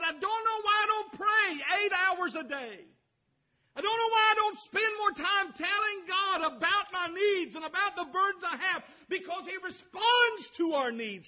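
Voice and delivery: high shouted quote, breaking into laughter; impassioned shout. Retold or imitated. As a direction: cry it out in a high voice, laughing with joy. Friend's impassioned confession quoted.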